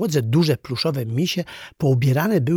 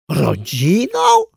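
loud, slow, rising disbelief; astonished; 6 semitones higher, 6 dB louder, much slower than usual. A narrator's reading. Toys hearing the clown call them family, astonished. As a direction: stretch the question out, high in amazement. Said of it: "zdziwiły się zabawki".

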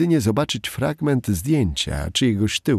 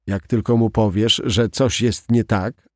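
usual speaking voice

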